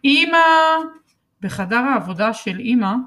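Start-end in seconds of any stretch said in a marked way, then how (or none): 0.0-0.4 high, loud call toward the next room; shout for mom. A narrator's reading. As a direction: yell for mom to come settle it. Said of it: "וצעק". (צעקה רמה לאמא)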